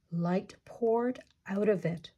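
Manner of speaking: ordinary delivery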